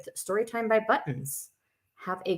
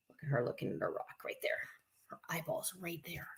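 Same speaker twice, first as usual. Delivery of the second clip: hushed aside; 5 semitones lower, 15 dB quieter than usual. Real, not acted. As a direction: breathe it under the story. Aside whispered during story time.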